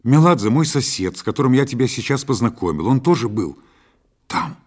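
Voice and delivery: loud, impatient insistence; exasperated outburst. A narrator's reading. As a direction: burst out, unable to hold back. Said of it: "не сдержался я".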